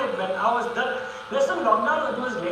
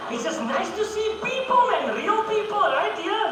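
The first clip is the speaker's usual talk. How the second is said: excited; 13 semitones higher, slower than usual. Real, not acted. Delighted to see live audience.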